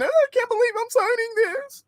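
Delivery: high whimpering fake sob; mock crying. Mocking the politician signing in tears, high fake sob. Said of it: "Tears dropping as she's signing".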